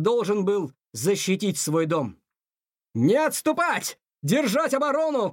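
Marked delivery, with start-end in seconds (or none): 2.9-5.3 battle cry. A narrator's reading. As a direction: cry out an order to hold the line. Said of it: "крикнул он". (боевой клич, высокий протяжный приказ)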